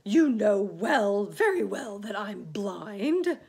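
loud, high irritated retort; offended earthworm outburst; snapping. Acted. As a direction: snap back, stretching the words. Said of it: "snapped the earthworm".

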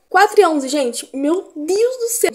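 loud, high alarm at the clock; shocked at the time. Real, not acted. Late vlogger seeing the time, loud alarmed exclaim. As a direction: gasp at how late it is.

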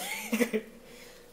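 high laugh through stomach ache; pained high laugh. Real, not acted. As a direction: laugh while holding your belly. Laughs over aching stomach.

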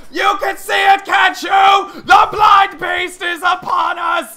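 shouting, high-pitched end-times prophet; raving doomsayer. Acted. Street prophet warning all who will listen, raving. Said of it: "you know, raving".

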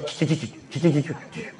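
soft, rapid "cik-cik" noises; quiet repeated chittering; imitated chirping clicks. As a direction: make a string of tiny soft clicks. Imitates small repeated noise in story.

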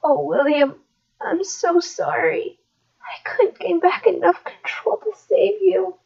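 high, soft, broken apologies; remorseful grief. Acted. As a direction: beg forgiveness over your dead brother. Brother regains control, child dead.

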